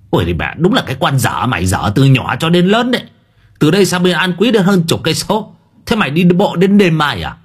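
high, incredulous telling-off; exasperated scolding. A narrator's reading. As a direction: scold as if she is being foolish. Old uncle scolds his niece.

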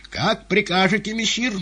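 high, loud servant's question; deferential query. A narrator's reading. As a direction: ask for orders in a high voice.

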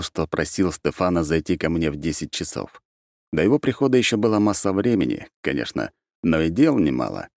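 ordinary delivery

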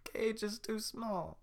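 high slow whispered sob; tiny bear weeping; crying whisper. Acted. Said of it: "little bear crying".

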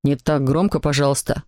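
low-pitched dad, restrained; father's voice. A narrator's reading. Papa asks the noisy caller to quieten, low fatherly voice. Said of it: "сказал папа".

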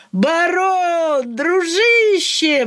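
high, drawn-out delighted cry; joyful exclamation. A narrator's reading. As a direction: greet an old friend with delight. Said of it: "воскликнул Ляпсус".

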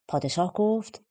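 ordinary delivery

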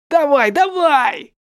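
high, loud, slow cheering thug; egging on. Acted. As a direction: cheer the beating on loudly. Thugs egg on beating. (подбадривающий крик)

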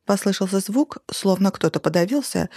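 ordinary delivery, nothing marked

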